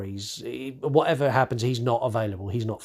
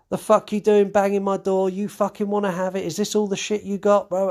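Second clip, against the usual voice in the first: higher, louder mock threat; aggressive challenge; 9 semitones higher, 3 dB louder than usual. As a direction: square up like a lad ready to fight. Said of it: "he's like".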